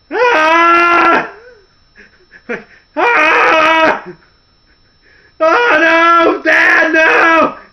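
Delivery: high, loud desperate cry; panicked yell. Retold or imitated. Big Show clinging to the casket, panicked yell. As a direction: yell like you're being dragged away. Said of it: "he's like".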